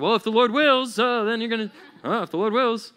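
high, loud pious correction; mock legalist. Legalist correcting someone's smoothie plan, mock officious. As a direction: butt in with hammed-up correction.

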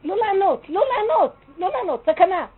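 high, loud repeated command; urgent loud warning. Real, not acted. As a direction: cry out the warning like an alarm. Urgent warning against answering back. (אזהרה דחופה בקול רם וגבוה)

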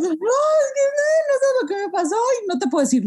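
bursting but can't tell; imitating her own panicked excitement; retold frantic. Retelling her frantic reply.

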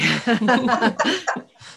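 a rising, teary laugh. Real, not acted. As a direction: laugh through welling tears. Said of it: "made me totally well up".

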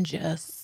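"gis" said like "jess" or "jiss"; mispronounced GIS. Retold or imitated. Host quoting candidate, mispronouncing GIS as "gis". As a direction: say "gis" as one J-word, not three letters. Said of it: "saying, like, a common word incorrectly".

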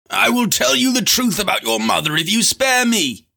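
high-pitched pleading scream. A narrator's reading. Lanlo bargains with Lorn for his life, high scream. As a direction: scream it high, begging for mercy. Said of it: "he screamed".

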